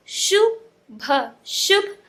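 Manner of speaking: first "शुभ" slowly in two sounds, then whole; broken, then joined. Shown as a demonstration. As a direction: stretch and split "शुभ", then blend it. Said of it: "तोड़ तोड़ के पढ़ना".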